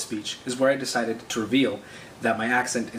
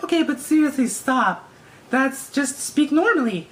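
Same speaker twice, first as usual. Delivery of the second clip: high, loud imitated girl voice; insistent girl; 13 semitones higher, 5 dB louder than usual. Man imitating a girl demanding he speak normally, insistent high voice. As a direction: plead in a mock girly voice, drawn out at the end. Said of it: "And she's like".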